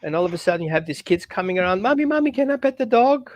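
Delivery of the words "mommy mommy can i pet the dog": put-on high, eager little kid begging; imitating a child. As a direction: mimic a kid pleading to pet the dog. Kids coming around, voiced.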